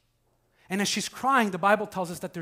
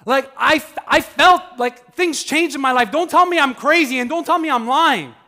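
loud, higher pleading outburst as Mary; raised voice of a grieving woman defending herself; imagined outburst; 5 semitones higher, 8 dB louder than usual. Retold or imitated. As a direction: cry out that you are not crazy or lying. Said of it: "You're like".

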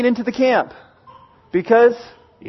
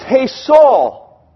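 raised, louder call to someone far off; 5 semitones higher, 5 dB louder than usual. Retold or imitated. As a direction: hail him from a distance. David calls out from the cave.

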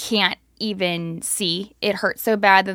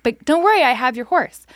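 higher and louder, eager to please; proud reassurance; 4 semitones higher, 5 dB louder than usual. Retold or imitated. Telling the neighbor she has the horse, proudly high. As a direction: reassure her like a hero.

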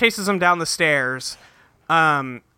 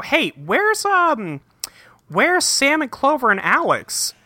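pitched way up and drawn out, playing dumb; high mock voice; 9 semitones higher, slower than usual. Retelling the show, voicing a clueless character in a high mock voice. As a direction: squeak the question like a clueless host. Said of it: "And he's like".

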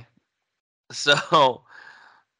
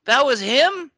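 high-pitched, incredulous question; real astonishment; 4 dB louder than usual. Real, not acted. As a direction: shoot up high in disbelief. Stunned by actor's identity.

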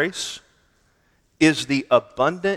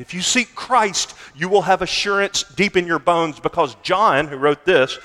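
louder, higher ringing exhortation; preaching at full force; 4 semitones higher, 5 dB louder than usual. Acted. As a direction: drive the promise into the hall.

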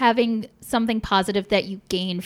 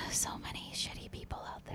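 breathy sighed whisper; 21 dB quieter than usual. Real, not acted. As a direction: sigh it out under your breath. Sighing aside about bad people.

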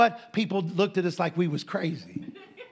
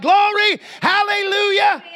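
high, loud, slow hallelujah; shouting praise; 12 semitones higher, 8 dB louder, slower than usual. Retold or imitated. Preacher and Ted celebrate in the store, shouting. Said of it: "both shouting".

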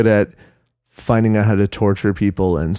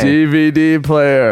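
high, slow call of the words; drawn-out catchphrase; 6 semitones higher, 4 dB louder, slower than usual. Acted. Friend quotes a show they both love, drawn-out catchphrase. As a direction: stretch it out high in mock excitement.

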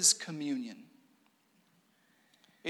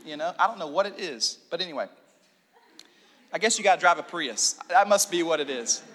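ordinary delivery

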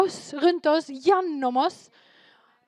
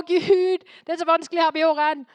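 a higher, louder, quicker plea in mock despair; mock whiny prayer; 4 semitones higher, 5 dB louder than usual. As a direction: whine at God as if everything is too hard. Mocking prayers from crisis to crisis. (hermende sutrende bønn)

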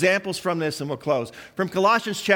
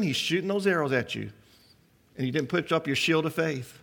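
ordinary talk, nothing marked

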